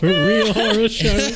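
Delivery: laughing. Real, not acted. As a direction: giggle out the punchline. Echoing the phrase while laughing.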